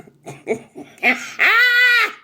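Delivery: big high-pitched burst of laughter; loud high laugh. Real, not acted. Reader amused at sounding like auntie, big high laugh.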